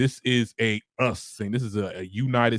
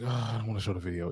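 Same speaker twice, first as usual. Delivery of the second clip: reluctant inner voice; 8 dB quieter, much faster than usual. Retold or imitated. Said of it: "I'm just like".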